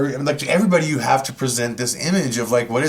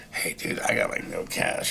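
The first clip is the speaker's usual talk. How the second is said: much lower and softer, a guilty admission; sheepish low confession; 10 dB quieter than usual. Retold or imitated. Telling Clint at the table he has no cash, hushed. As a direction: confess it under your breath.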